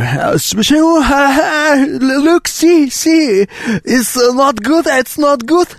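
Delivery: high, excited broken English; playing a foreigner who can't speak English; broken English tourist. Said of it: "я говорю". (ломаный английский туриста)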